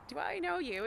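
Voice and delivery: a hint of an elderly Welsh grandmother; gently asking in a Welsh accent. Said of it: "a beautiful Welsh accent".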